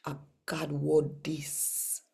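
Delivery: ordinary talk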